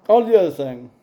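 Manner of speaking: talking through a yawn; words stretched by a yawn. Real, not acted. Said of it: "forgive me, I'm yawning".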